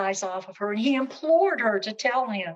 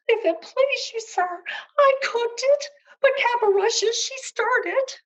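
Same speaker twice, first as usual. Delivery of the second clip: crying cook; 6 dB louder than usual. Acted. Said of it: "the cook began to cry".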